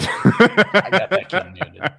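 slow, laughing through the words; talking while laughing. Real, not acted. Laughing about a muted player.

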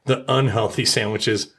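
normal talking voice

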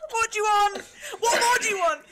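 high, loud, laughing fed-up demand; exasperated customer voice. Retold or imitated. Exhausted waitress mocking herself to friends, high loud and exasperated. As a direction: bark it at the customer through laughter. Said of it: "More of a, like".

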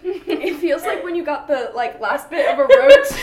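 laughing helplessly between words. Real, not acted. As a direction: giggle through a burning mouth. Laughing through the burning chip.

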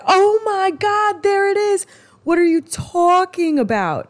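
high, loud mock astonishment; gleeful, high-pitched echo of someone's words; delighted teasing. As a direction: imitate excited listeners pouncing on an accent. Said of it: "they love my New York accent".

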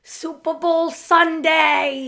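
high, loud, drawn-out cheer; excited shout. Real, not acted. Co-host answering what today is, big excited shout. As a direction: shout it out slowly like an announcement.

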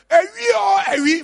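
high, loud community alarm cry; shouted alarm. Acted. Villager raising the alarm so everybody comes, shouting. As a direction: shout it out to rouse everybody around. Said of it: "when people have shouted".

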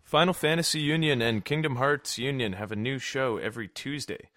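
promo voice, soft and steady; announcer read. Acted. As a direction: read it like a podcast plug. Reads the show promo.

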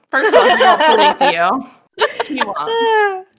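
real giggling objection, pitched high; laughing protest. Real, not acted. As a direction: laugh it off in disbelief. Laughing protest about husband.

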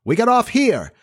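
high-pitched captain's cry. A narrator's reading. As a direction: call it out as a captain's order. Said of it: "cry in his captain voice".

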